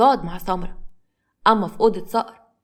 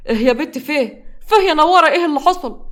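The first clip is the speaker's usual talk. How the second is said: alarmed, loud and higher questioning; startled; 6 semitones higher, 6 dB louder than usual. A narrator's reading. Hamza woken by his wife's screams, startled and alarmed. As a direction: jolt awake in a panic. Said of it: "وهو مفزوع".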